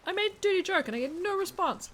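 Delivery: high, loud indignant complaint; mock outrage. Real, not acted. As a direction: act offended.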